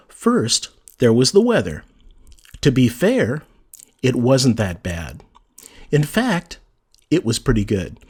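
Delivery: first, pause, then the weather, pause at each comma. Shown as a demonstration. Teacher reading example sentences to students, pausing at each comma. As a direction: let each comma breathe with a short pause. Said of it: "Notice how there, there's that little pause where the comma occurs".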